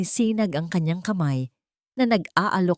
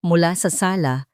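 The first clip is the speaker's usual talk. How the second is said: plain reading voice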